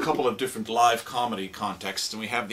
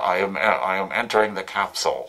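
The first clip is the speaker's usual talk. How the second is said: lower, louder voice through a cone speaker; mock space radio voice through a cone; astronaut through cone; 4 semitones lower, 5 dB louder than usual. Shown as a demonstration. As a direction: talk like an astronaut on a helmet radio. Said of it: "astronauts have to put on their helmets and talk to each other".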